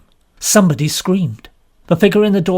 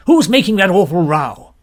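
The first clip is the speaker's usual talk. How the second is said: gruff colonel; 6 dB louder than usual. A narrator's reading. Colonel irritated by screaming.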